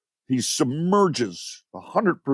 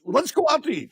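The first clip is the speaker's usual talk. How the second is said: higher mock wife voice; husband imitates his wife's question in a higher voice; mimicking his wife; faster than usual. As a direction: play the wife, pitched up and light. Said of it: "She goes".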